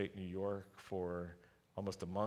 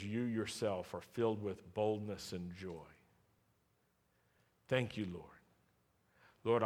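prayer; 3 semitones lower, 4 dB quieter than usual. Acted. Pastor praying softly over the congregation. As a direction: pray gently over the people.

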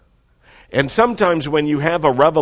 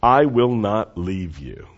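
slow, solemn promise; 3 semitones lower than usual. Shown as a demonstration. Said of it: "Say that with me".

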